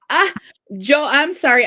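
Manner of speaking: ordinary talk, nothing marked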